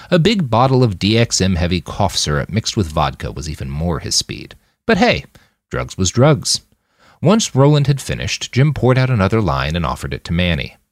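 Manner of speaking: ordinary delivery, nothing marked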